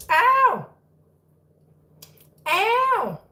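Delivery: high "ow", easing in volume; whiny cry at a kitten's nip; pained yelp. Real, not acted. Kitten bites again.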